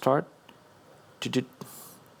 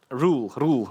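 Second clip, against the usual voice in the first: put-on a sudden, brief English accent. Presenter slipping into an English accent, English accent. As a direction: say it with an English accent, as a passing joke. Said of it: "not sure where the English accent came from".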